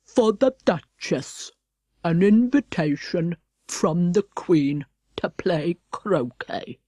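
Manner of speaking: slow, formal footman voice; solemn slow footman, falling low. Acted. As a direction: announce it with stiff ceremony. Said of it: "saying in a solemn tone".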